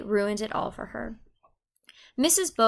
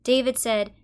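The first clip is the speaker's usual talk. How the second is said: ordinary delivery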